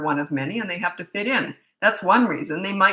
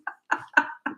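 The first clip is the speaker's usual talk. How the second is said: breathless laugh. Real, not acted. Laughing at own crying story.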